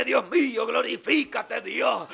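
ordinary delivery

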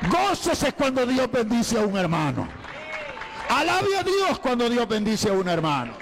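high, loud preacher's exhortation; fervent preaching. Acted. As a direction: call the church to rejoice at full voice. (predicación fervorosa, aguda y fuerte)

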